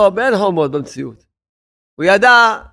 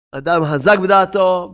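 usual speaking voice

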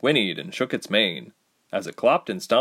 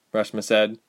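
ordinary talk, nothing marked

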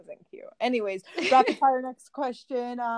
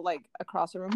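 usual speaking voice

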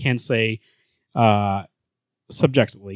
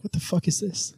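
mock confused bystander; 7 semitones higher, 8 dB quieter than usual. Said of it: "people would be like".

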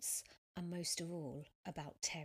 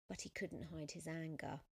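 ordinary delivery, nothing marked

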